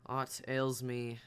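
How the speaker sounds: very slow flat reply; slow deadpan. Acted. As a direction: drag it out deadpan.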